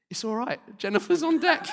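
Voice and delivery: high, light, comic voice; mock reassured thought. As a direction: toss it off high, as a half-awake sailor reassuring himself. Sleepy sailor's thought, acted comically.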